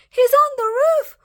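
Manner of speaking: very high, loud, stuttering squeal; stammering panic. Acted. Piglets panic at wolf.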